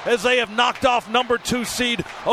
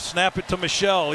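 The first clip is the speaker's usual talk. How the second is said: normal talking voice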